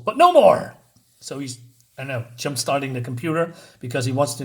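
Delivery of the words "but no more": higher and louder, final threat; villain's vow. Acted. Fool Killer swears Spider-Man's fate, loud and higher. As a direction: slam the last words down.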